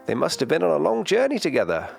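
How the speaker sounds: high, quick grandmother voice; Grandma character voice. A narrator's reading. Grandma to Noi about the birds, grandmother voice. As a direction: lift the pitch high and speed along.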